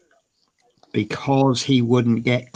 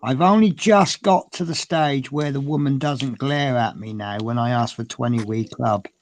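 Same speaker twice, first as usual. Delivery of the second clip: ordinary talk, nothing marked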